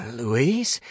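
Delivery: questioning, pitch lifting at the end; tentative call. A narrator's reading. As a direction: call out to see if the friend is there. Calling for ghostly friend.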